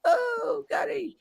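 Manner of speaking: mock whiny moan, soaring way up high; moaning commentator. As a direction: whinge like a pundit crying over a tackle. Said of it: "Gary Neville moaning his head off".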